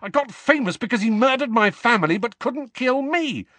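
Harry boiling over; spluttering. A narrator's reading. Harry splutters at his friends, high and loud. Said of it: "Harry spluttered".

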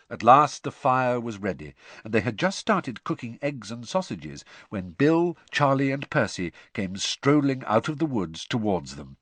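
ordinary delivery

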